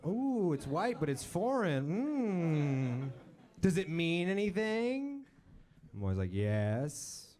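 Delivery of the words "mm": ordinary talk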